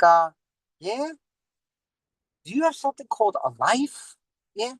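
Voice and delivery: bug-eyed disbelief at a news addict; Sebastian Maniscalco impression. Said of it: "I'm doing my Sebastian Maniscalco".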